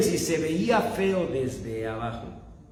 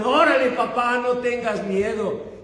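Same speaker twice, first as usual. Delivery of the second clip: put-on kids yelling; 6 semitones higher than usual. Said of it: "mis hijos me gritaban".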